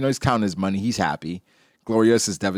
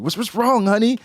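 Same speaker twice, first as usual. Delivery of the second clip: high, loud, fussing wife voice; worried wife voice; 12 semitones higher, 4 dB louder than usual. Retold or imitated. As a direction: fret over her husband in a high voice. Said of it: "your wife is asking".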